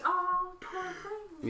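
mock pity. Mock pity for Mary's story.

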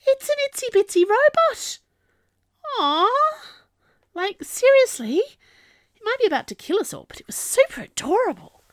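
squealing high voice, fast at times; gushing excitement. A narrator's reading. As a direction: squeal over something cute. Narrator gushes over tiny robot.

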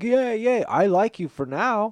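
high, loud, eager dog chorus voice; excited group of dogs. Acted. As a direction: yap it like a pack of friendly dogs. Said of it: "when they're all talking as a group".

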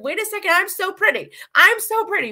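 the penny drops and you love it; delighted realization. Retold or imitated. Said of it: "until eventually I'm like".

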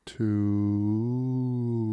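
one word stretched right out; exaggeratedly slow. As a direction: draw TWO out very long. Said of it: "Can you say that a little bit slower".

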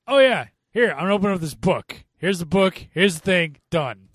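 mock the actress skipping every step; mocking Angelina Jolie. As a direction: rattle it off, dismissively quick. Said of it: "Angelina Jolie's like".

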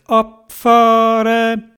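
high, slow, sung-out launch call; departure call. Acted. Theobald sends all the animals off, long high call. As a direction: hold the vowels long on a high note.

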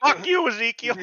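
high, loud put-on curse; hammed-up insult, laughing at it; mock cursing Ezekiel. Mocking Ezekiel for his friends, hammed-up high curse. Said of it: "I'm just like".

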